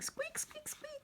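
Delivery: very high, soft squeaky mouse; tiny mouse squeaking home; mouse squeaks. Acted. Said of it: "And she's saying".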